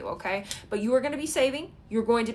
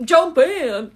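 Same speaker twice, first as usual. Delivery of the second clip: loud, higher put-on Ken voice; [name] quote; 7 semitones higher, 12 dB louder than usual. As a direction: belt it like Ken in the song. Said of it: "they're from the song [name]".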